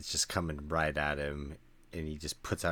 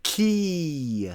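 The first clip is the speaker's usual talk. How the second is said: high, loud drawn-out yell; full-voiced character shout; 10 semitones higher, 10 dB louder than usual. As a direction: yell it out long, falling in pitch at the end. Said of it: "he just yells".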